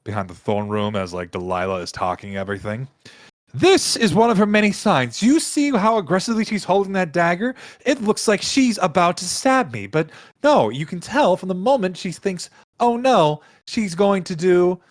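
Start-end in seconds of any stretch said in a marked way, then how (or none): 3.5-14.8 high, emphatic courtroom accusation; indignant royal accuser. Acted. Ruler showing the court proof of treason, indignant and high-pitched. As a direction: present the evidence with exaggerated outrage. Game master voices Delilah presenting evidence.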